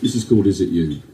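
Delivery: ordinary delivery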